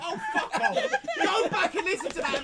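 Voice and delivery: cracking up through the words; real laughter breaking the line; laughing. Real, not acted. Group bursts out laughing.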